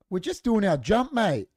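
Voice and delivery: put-on a bratty teenager sneering back at the neighbour; cheeky teen mockery. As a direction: ham up a mouthy teenage lout. Teens defiantly answering back.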